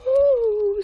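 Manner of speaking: high, loud startled cry; frightened cry. A narrator's reading. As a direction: gasp in fright. Millicent gasps in fright.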